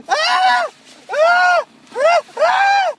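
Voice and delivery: high shrieking yells of frustration; frustrated screams. Real, not acted. Host stuck in his coat, screaming high. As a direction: scream at the coat you can't escape.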